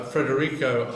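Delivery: ordinary talk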